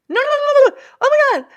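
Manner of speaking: high, loud horrified no no no; mortified panic. Retold or imitated. Owner realising she insulted a driver's wife, horrified high shriek. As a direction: shriek your horror at the blunder. Horrified denial in retold scene.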